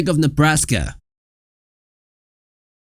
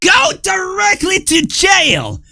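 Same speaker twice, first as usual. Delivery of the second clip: mock shout; 14 semitones higher, slower than usual. Sudden mock game announcement.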